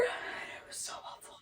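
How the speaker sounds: barely breathed words; real whisper. Real, not acted. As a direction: let it slip out as a hushed whisper. Reliving daughter's seizure.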